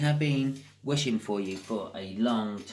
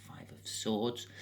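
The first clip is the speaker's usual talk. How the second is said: whisper; 7 dB quieter, slower than usual. Real, not acted. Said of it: "whispered card name".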